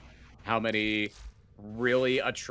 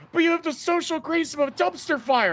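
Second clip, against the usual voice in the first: younger self voice. Retold or imitated. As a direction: play your clueless college self. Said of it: "College me would have been like".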